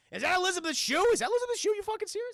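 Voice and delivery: high-pitched, startled disbelief; incredulous. Real, not acted. Streamer doubting it is Elizabeth Shue, incredulous. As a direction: question it in climbing, astonished pitch.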